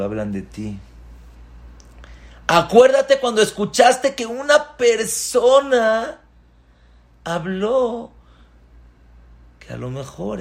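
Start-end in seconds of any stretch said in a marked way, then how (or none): 2.5-8.1 high, loud call; raised urging; emphatic reminder. Real, not acted. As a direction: drive the point home.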